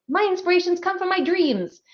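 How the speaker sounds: high-pitched mock earnest quote; mock dreamy earnestness. Author mimicking people who get ideas from dreams, mock earnest. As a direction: imitate a gushing dreamer, pitched well up.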